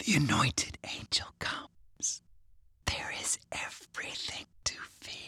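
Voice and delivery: a chilling unvoiced whisper from a possessed child; ice-cold whisper. A narrator's reading. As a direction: breathe it out cold and inhuman. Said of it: "the voice was ice cold".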